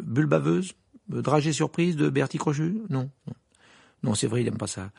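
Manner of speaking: tentative guesses with rising pitch; hesitant Harry. A narrator's reading. Harry guessing passwords at the gargoyle, hesitant. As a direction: try each one out, unsure.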